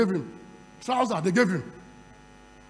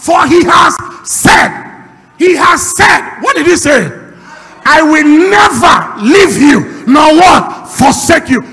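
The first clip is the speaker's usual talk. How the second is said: loud, fervent preacher calling to the church; a pastor firing up his congregation, loud and fervent; preaching at full force; 3 semitones higher, 15 dB louder than usual. Acted.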